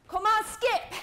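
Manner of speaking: loud, high coaching call; urging shout. Real, not acted. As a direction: yell them into the next move. Coach calling out next exercise.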